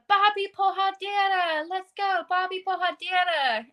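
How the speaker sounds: high-pitched mock scream in a Brazilian Portuguese accent; Portuguese-accented coach yelling. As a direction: imitate a Brazilian coach hollering high, without real volume. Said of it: "my coach screaming at me in his Portuguese".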